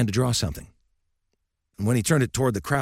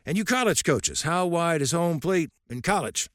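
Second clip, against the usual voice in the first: put-on lightly raised, gruff old coach lecturing; old coach's voice; 10 semitones higher than usual. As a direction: speak as a stern old baseball coach.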